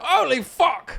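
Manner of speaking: very high mock British exclamation; mock British accent. Co-host echoing the shocked cleaner, British accent. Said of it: "Like, in a British way".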